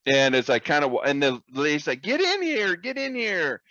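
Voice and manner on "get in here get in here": put-on high old lady voice.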